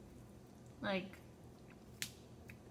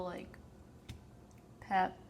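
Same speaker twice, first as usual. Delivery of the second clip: ordinary delivery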